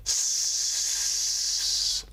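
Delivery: long unvoiced hiss. Shown as a demonstration. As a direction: hiss like air from a tire. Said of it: "making the s- sound".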